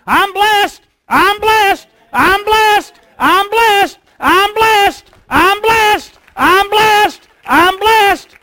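high, loud exultant shouting; joyful shout. Acted. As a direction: shout your blessing to everyone. Congregant shouting her blessing.

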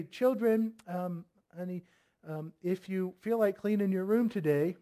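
soft, halting, tentative request; hesitant, coaxing mock plea; mock timid parent. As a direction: imitate a parent afraid to give orders. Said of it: "did mom and dad talk to you like that".

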